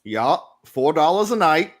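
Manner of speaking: put-on old New England sailor naming his rate; New England accent. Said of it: "It's a New Englandy thing".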